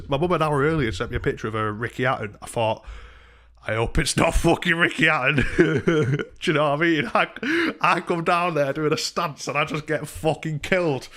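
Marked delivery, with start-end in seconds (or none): 3.7-11.1 high, loud, amused telling; laughing while telling. Real, not acted. Telling how he might get killed downstairs, laughing. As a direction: laugh through the words, pitched up. Laughing at his own imagined fear.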